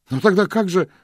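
put-on high, loud boyish bewilderment; baffled outburst. A narrator's reading. Boy baffled, breaks off. (растерянный высокий возглас)

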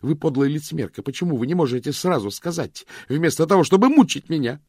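high, loud angry accusation; furious outburst. Acted. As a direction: lash out in rage. Vivien furious outburst at Claire.

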